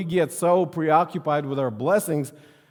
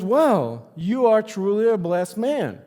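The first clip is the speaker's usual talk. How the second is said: impressed friend; 7 semitones higher, 7 dB louder than usual. Retold or imitated. Friend voiced in joke.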